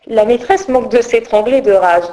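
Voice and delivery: ordinary delivery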